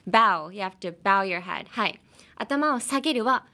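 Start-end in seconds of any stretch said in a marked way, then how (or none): 0.1-0.5 bow said firmly, rhyming with now; bow louder, corrected to rhyme with cow; firm correction, bow like now. Shown as a demonstration. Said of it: "I almost said bow".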